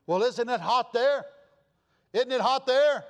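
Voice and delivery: loud, high-pitched challenge; incredulous retort. Retold or imitated. Host answering a guest's complaint about the heat, loudly incredulous.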